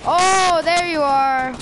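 loud, high "found you" shout; excited call. Real, not acted. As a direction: yell it out as you spot him. Finally finds his opponent.

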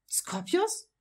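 high, rising surprise; surprised question. A narrator's reading. Draco questioning his father's choice of name, surprised. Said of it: "fragte Draco überrascht".

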